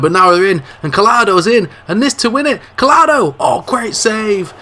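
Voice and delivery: climbing into a full goal-mouth shout; rising excitement. Acted. Commentator calling a last-minute chance, building to an excited shout. Late chance to win the game.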